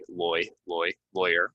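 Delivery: "loy, loy, lawyer" as LOY, LOY-er; rhymes with boy. Shown as a demonstration. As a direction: drill the oy, then "lawyer". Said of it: "rhymes with boy".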